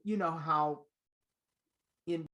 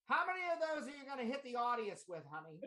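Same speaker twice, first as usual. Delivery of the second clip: high, quick imitation of an old mezzo; 8 semitones higher, 4 dB quieter, faster than usual. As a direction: imitate the veteran mezzo quizzing a soprano, pitched high. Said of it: "She says".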